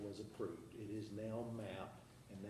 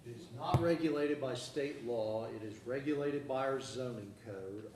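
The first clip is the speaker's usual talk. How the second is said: louder and clearer, repeated; to someone hard of hearing; 2 semitones higher, 7 dB louder than usual. Real, not acted. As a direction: speak up for the back of the room. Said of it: "Could you, could you speak up".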